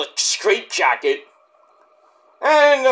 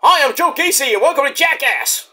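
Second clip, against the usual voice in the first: high, hyped show-opener parody; Joe Gacy doing Jackass intro; 7 semitones higher than usual. Acted. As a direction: announce yourself like a stunt-show host.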